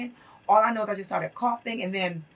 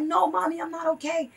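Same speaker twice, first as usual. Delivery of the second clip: retold pleading; 7 semitones higher than usual. Jailed son pleads to his mother.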